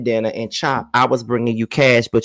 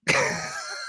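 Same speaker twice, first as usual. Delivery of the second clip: breathy laugh; 9 dB quieter than usual. Real, not acted. Laughing at the texts.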